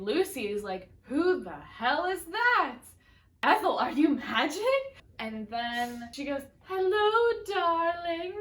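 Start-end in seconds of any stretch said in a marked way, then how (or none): 1.0-4.6 Lucy spooked by the other voice; startled shout. Retold or imitated. 6.6-8.3 very high, loud sing-song greeting; Fred's falsetto dead wife; mock ghostly wife. Fred voices dead wife.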